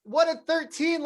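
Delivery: high-pitched, acted-out incredulity; playfully replayed astonished double take; stunned disbelief. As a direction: halt them in disbelief, pitch jumping up. Acting out his stunned reaction.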